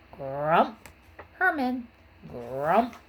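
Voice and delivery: lightly croaked frog sounds. A narrator's reading. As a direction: croak it like a bullfrog. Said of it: "he croaked".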